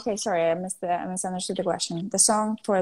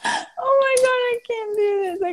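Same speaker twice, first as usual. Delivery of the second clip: mock-wailing protest; 12 semitones higher than usual. Real, not acted. Begged by co-host to try an accent, mock-wailing. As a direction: wail that you can't, playfully.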